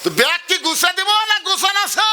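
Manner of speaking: wife shouting at her husband about packing; taunting shout. Acted. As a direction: yell it to needle him.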